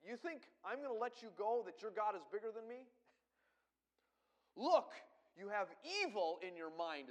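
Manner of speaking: high, loud scornful taunt; sarcastic Pharaoh. Acted. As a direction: taunt them like a mocking king. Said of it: "this is really a sarcastic statement".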